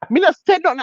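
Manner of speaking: ordinary talk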